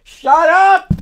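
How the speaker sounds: high, loud, real annoyed yell; shout shut up. Real, not acted. Vlogger yelling at her dad in the background, high annoyed shout. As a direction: snap it out hard to silence him.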